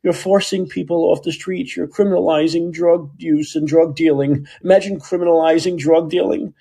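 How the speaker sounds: high-pitched mocking imitation of a politician; exaggerated high, indignant protest; Dean Preston. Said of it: "You know, Dean Preston saying".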